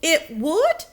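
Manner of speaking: high startled question; surprised girl. Acted. Rita surprised by the teacher, high. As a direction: squeak it up in disbelief. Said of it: "said Rita".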